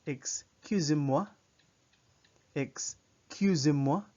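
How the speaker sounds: slow, loud model "Excusez-moi", rising at the end; French pronunciation. Shown as a demonstration. Teacher models "Excusez-moi" for French learners, slow careful pronunciation. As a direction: clear French "Excusez-moi" with a held, lifted "moi". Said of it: "this is the word".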